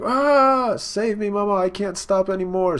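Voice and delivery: high, loud opening ah, then begging; panicked cry. Retold or imitated. Muscat begging Mama for mercy, a high panicked cry. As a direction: yelp and plead for rescue.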